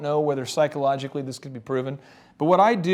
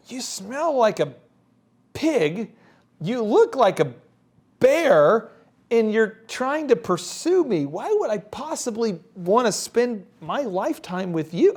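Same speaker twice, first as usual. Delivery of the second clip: slow, higher, drawn-out disbelief; mock disgust; 5 semitones higher, slower than usual. The other side judging man bear pigs, mock disgust. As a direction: recoil from an unworthy suitor. Dates voiced judging men.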